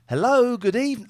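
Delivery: high, loud woman's voice; high lady voice. Acted. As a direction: squeak out a lady's greeting. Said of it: "I'm a lady".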